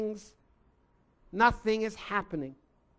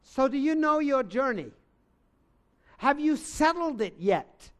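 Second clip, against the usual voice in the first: urgent, louder preaching plea; altar call appeal; 3 semitones higher, 4 dB louder than usual. Acted. Preacher urges listeners to give their lives, louder urgent appeal. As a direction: press them to decide now.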